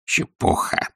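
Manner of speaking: soft, grumbled dismissal; Скрудж. A narrator's reading. Scrooge adding to his repeated dismissal, grumbling. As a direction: grumble it as an afterthought. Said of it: "и присовокупил ещё".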